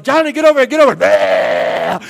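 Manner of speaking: loud exasperated call across the store; mother yelling. Retold or imitated. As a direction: yell for the runaway child. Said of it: "Mommy's yelling".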